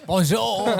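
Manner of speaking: laughing French bonjour; French accent. Acted. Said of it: "we can do the accent".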